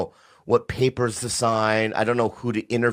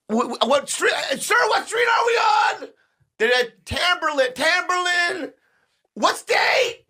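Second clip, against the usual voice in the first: very high, panicked cry-shout; hammed-up hysterical caller; slower than usual. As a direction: fall apart on the phone.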